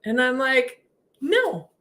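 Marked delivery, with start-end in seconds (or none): 1.2-1.7 high stubborn no; mock refusal. Told to look at the whole room, high comic refusal. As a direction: refuse playfully, pitch jumping up.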